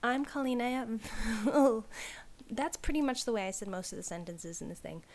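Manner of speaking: plain reading voice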